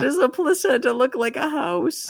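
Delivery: high, laughing disbelief. Real, not acted. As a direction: laugh through the absurdity. Laughing at absurd image.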